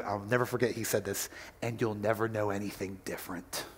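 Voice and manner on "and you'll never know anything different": low, soft fatherly promise; tender reassurance. Retold or imitated. Father promising love to his son, low tender voice. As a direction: lower the voice and promise gently.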